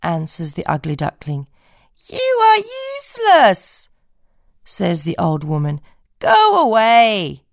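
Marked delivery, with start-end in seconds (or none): 2.1-7.4 peer at the odd creature; old woman voice. Acted.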